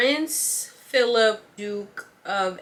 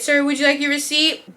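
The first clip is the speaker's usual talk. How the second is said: higher, louder sugary politeness; fake customer-service politeness; 6 semitones higher, 6 dB louder than usual. Cashier wanting the rude customer gone, forced sweet politeness. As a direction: smile through it, sweet but done. Repeats receipt offer.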